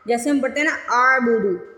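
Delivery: usual speaking voice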